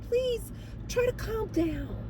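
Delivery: high, soft, slow coaxing; soothing plea. Retold or imitated. Manager calming a crying resident on phone, soothing. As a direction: gently settle a hysterical caller.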